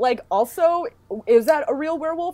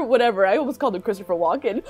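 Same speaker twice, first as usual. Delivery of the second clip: ordinary talk, nothing marked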